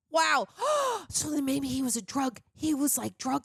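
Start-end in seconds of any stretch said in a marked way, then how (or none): 0.5-1.0 high, sudden intake of breath; sharp gasp. Real, not acted. Film reviewer reacting to her own drug theory, gasping. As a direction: gasp as if struck by a revelation.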